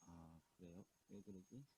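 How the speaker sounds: barely audible phone line; very low, distant phone voice; faint connection. Real, not acted. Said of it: "너무 적어". (멀리서 작게 들리는 통화 소리)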